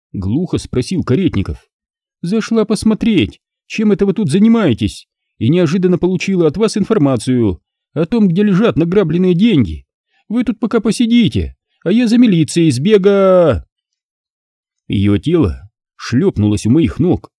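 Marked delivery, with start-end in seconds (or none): 2.2-13.6 high, mocking woman's voice; scornful blonde vixen jeering; sarcastic female voice. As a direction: sneer it down from above. Alisa taunting the captives.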